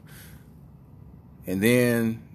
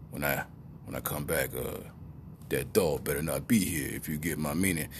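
hitman; 6 semitones lower, 6 dB quieter than usual. Retold or imitated. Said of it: "given a mission like a hitman".

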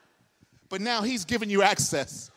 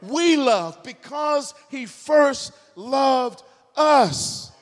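rising, loud, high preacher's cry; preaching at full force; 3 semitones higher, 4 dB louder than usual. Acted. Pastor proclaiming the cross to his congregation, shouting. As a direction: build it up and proclaim it to the room.

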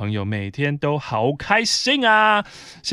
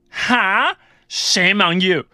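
mock scornful shaming; 7 semitones higher, 6 dB louder, much slower than usual. Coworker shaming her English.

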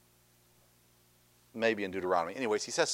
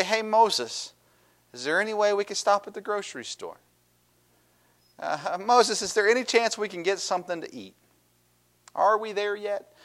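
polite asking; 7 semitones higher, 4 dB quieter than usual. Acted. Polite request voiced as alternative.

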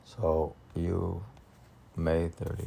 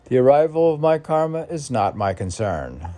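emphatic recital; 6 semitones higher, 8 dB louder than usual. Acted. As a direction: declaim the line louder. Reading a poem line.

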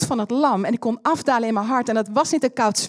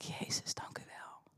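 a whispered, moved thank-you; hushed whisper of gratitude; 22 dB quieter, slower than usual. Retold or imitated. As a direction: breathe it out, almost silent. Her grateful reply to Jesus.

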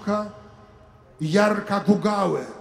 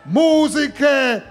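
higher, louder, slowing into long calls; preaching call; 5 semitones higher, 4 dB louder, slower than usual. Acted. Priest calling listeners from the window, rising preaching shout. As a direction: proclaim it from the window, building. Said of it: "Będę wzywał uparcie z tego okna".

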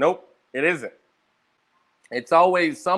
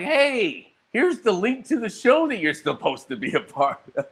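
chirpy host voice; 6 semitones higher than usual. Retold or imitated. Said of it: "And they're like".

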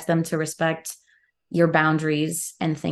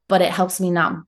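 normal talking voice

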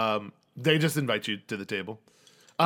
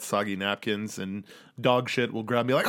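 usual speaking voice

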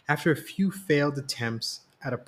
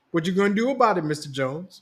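teasing, higher playful challenge; sly smile; 7 semitones higher, 4 dB louder, faster than usual. A narrator's reading. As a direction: tease her husband with a smile. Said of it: "Mom said with a sly smile".

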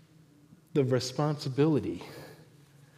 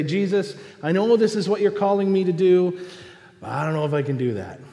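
higher, louder plea, then quick doubt; hesitant believer; 5 semitones higher, 5 dB louder, faster than usual. Acted. Believer answering Jesus's call, lightly acted hesitant plea. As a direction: plead with a touch of overwhelm. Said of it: "We might say".

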